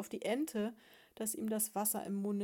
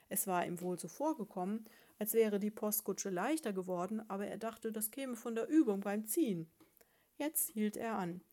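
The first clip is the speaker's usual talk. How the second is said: ordinary delivery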